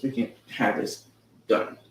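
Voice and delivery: usual speaking voice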